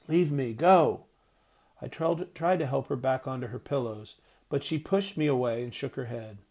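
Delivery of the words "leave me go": ragged shout. A narrator's reading. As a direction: shout her away with the last of her strength. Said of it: "Mother shouted in a ragged voice".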